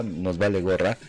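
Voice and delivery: normal talking voice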